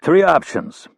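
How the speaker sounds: high, loud announcement. A narrator's reading. Rapp squaring up to the bouncer, loud announcement. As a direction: call it out hard and loud. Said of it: "Rapp said in a loud voice".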